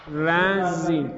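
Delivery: رزی drawn out very slowly, each sound clear; slow careful word. Shown as a demonstration. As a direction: spell out رزی slowly for the class. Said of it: "دقت کنید".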